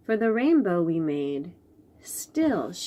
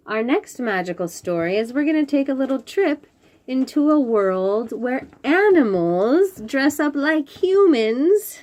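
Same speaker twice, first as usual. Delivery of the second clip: higher, louder, building excitement; excited intro; 5 semitones higher, 7 dB louder than usual. Acted. Host announcing the next story to the children, excited. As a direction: sell the next story with a thrill.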